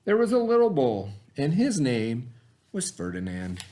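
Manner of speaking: put-on lively picture-book narration for young listeners; reading to children. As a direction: tell it like bedtime for a class of kids. Reads picture book to kids.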